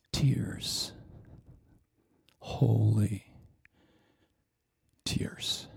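fading to a whisper; hushed. Acted. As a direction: let the word die away softly. Preacher drops to hush.

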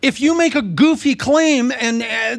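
usual speaking voice

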